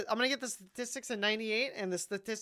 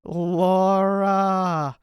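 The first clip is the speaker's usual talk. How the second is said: droning name call; 5 semitones lower, 9 dB louder, much slower than usual. Pretend. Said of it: "all it is is just me saying".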